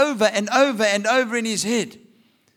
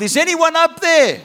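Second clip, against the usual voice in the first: desperate shout upward, higher and louder; yelling for help; 8 semitones higher, 6 dB louder than usual. Acted. Man hanging off a cliff shouting up for help. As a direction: call up the cliff for rescue.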